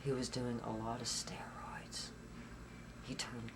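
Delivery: breathy whisper as it gets hard to say. Real, not acted. She drops to a whisper. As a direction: whisper it to the interviewer, reliving the drugs.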